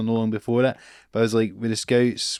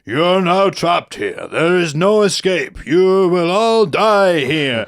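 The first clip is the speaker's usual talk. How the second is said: very slow, louder, menacing ghost-ride host; Dracula-like host; 4 semitones higher, 6 dB louder, much slower than usual. Retold or imitated. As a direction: drag out each word like a horror host trapping his guests. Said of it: "kinda Dracula looking guy comes on".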